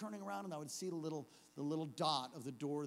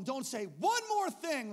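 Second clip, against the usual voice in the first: slow, loud, raised command; stern order; 8 semitones higher, 7 dB louder, slower than usual. Retold or imitated. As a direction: spell out the warning forcefully. Ordering the kid silent.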